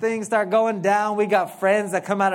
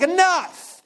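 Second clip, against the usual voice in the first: much higher, louder exasperated shout; fed-up parent; 13 semitones higher, 6 dB louder than usual. Retold or imitated. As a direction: snap it like a parent at the end of their rope. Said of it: "finally the Lord is like".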